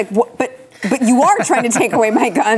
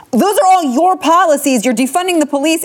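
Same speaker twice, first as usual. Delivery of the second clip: angry; 9 semitones higher, 3 dB louder than usual. Real, not acted. Heated rant at opponents.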